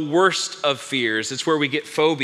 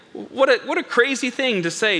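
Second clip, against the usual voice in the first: ordinary talk, nothing marked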